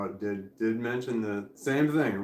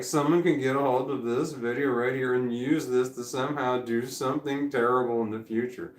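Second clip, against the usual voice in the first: ordinary talk, nothing marked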